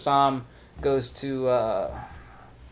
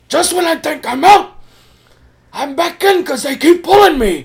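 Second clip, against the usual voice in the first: hammed-up screaming movie mobster; very high, loud mock-anguished shout; Al Pacino; 14 dB louder than usual. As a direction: yell the film line at full tilt. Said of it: "screaming shit like that".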